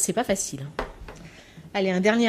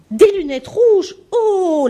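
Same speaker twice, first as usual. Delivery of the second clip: much higher, louder and slow; teacher's amazed exclaim; 10 semitones higher, 7 dB louder, much slower than usual. Retold or imitated. Teacher to child with red glasses, high slow loud amazement. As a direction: squeal of wonder at red glasses. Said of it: "dit la maîtresse".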